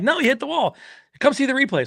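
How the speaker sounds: high-pitched urgent correction; acted high, frantic urging; imitated excited insistence. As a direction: insist like a worked-up onlooker. Voicing insistent excited crew in story.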